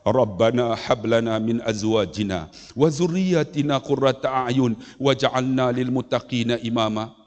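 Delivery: low, measured Arabic supplication; solemn recited dua, retold; praying father. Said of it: "Dia doa apa?".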